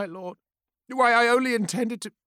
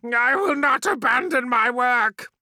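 loud, raised defiant cry; defiant shout; 11 semitones higher, 6 dB louder than usual. Acted. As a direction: shout your refusal. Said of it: "screamed Efrix".

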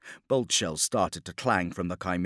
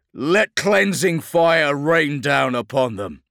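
loud, high, slow proclamation; battle exhortation; 6 semitones higher, 9 dB louder, slower than usual. Acted. Captain calling down fire on traitors, battle exhortation. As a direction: declaim it like a war cry.

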